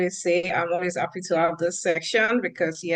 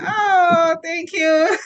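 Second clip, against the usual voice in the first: high, bright, delighted thank you with a laugh; delighted squeal; 10 semitones higher, 5 dB louder, much slower than usual. Real, not acted. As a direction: gush with surprised delight. Audience says they miss her.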